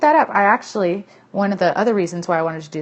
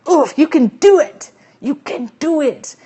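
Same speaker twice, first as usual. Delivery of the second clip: high, slow movie-coach cheer, much louder; Adam Sandler quote; 8 semitones higher, 8 dB louder, slower than usual. Retold or imitated. Host quoting a movie line to cheer viewers, slow and high. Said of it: "that's from some Adam Sandler movie".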